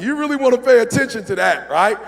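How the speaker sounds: high and loud, pressing the point; pointed warning. Retold or imitated. Speaker warning the overconfident flyer, high and loud. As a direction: push the warning hard at them. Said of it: "You're like".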